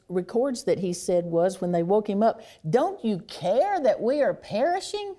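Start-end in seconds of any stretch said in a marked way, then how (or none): 2.7-5.1 high, urgent plea of frightened men; desperate plea over the storm; panicked cry. Retold or imitated. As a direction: cry out as if the boat is sinking.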